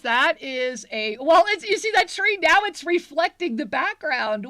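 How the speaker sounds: wowed by the crystal; excited showing off. Real, not acted. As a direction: show it off with big excitement.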